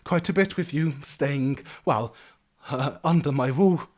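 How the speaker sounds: high chattering halfling landlady; motherly innkeeper. Acted. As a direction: introduce yourself with fussy warmth. Innkeeper introduces herself.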